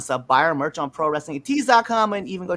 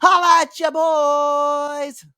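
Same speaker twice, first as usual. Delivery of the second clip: drawn-out hype shout of the catchphrase, slower; 10 semitones higher, 6 dB louder, much slower than usual. Shown as a demonstration. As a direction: stretch every word, hype man style. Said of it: "I guess we could do that".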